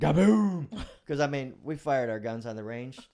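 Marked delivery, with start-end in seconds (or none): 0.0-0.7 loud, higher gaboom gunshot noise; mock booming gunshot; imitated gun boom. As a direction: boom it out again like a big gun. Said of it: "I'm expecting gaboom".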